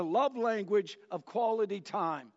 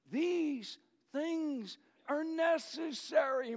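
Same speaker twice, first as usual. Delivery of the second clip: words of affirmation; 4 semitones higher than usual. Retold or imitated. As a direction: lift her up with every line. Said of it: "Words of affirmation".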